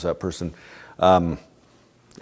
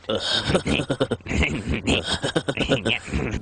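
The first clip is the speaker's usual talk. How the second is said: higher-pitched run of stuttering cartoon snickers; Beavis laugh; 5 semitones higher than usual. Shown as a demonstration. Demonstrating the character's laugh, long stuttering snicker. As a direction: keep a manic snicker going in bursts. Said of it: "the Beavis laugh".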